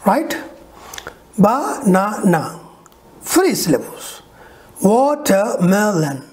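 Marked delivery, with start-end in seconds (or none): 1.4-2.6 Banana slowly in three pieces, ba, na, na; syllable by syllable. Shown as a demonstration. Teacher showing three syllables, slow and segmented. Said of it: "how you can break". 4.8-6.2 Watermelon slowly in four beats, wa, ter, me, lon; syllable by syllable. Shown as a demonstration. Teacher showing how to break the syllable, slow and segmented. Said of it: "how you can break".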